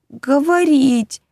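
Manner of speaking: high, slow pleading. Acted. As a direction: beg for it desperately. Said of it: "взмолился Мишка".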